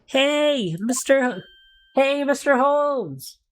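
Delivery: slow, high call across the room. Acted. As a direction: call his name out. Calling out to a character.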